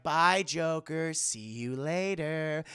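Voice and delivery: slow, singsong taunting goodbye; lightly voiced teasing woman; Pamela. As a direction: stretch the farewell out to needle him. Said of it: "Pamela's like".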